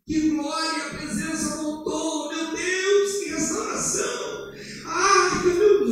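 high, slow, half-sung cry of joy; hammed-up praise that rises to a squeal; rejoicing. Pastor acting overjoyed at the presence returning, high half-sung praise.

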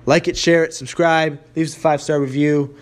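loud, pumped-up call to subscribe; loud hype sign-off. Real, not acted. Energetic podcast sign-off plea.

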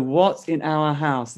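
What punched, then slow and falling on in our house; slow reading with the weight on What; stress on what. Shown as a demonstration. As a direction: hit the word What and let the rest fall slowly. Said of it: "that's gonna become an important line".

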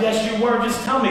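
heated retold argument; angry. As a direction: press them to admit it. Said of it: "that much anger going on".

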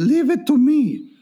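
put-on old nonna. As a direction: play the nonna taking charge. Retold grandmother's promise.

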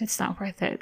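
soft, hushed reassurance; very calm. Retold or imitated. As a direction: say it very quietly to settle someone down. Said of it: "very calm".

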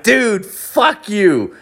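high, slow, drawn-out retort; mock-indignant high-pitched cussing; retold outrage. Telling off a friend over his price, mock outrage.

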